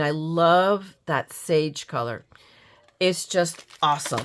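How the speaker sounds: ordinary talk, nothing marked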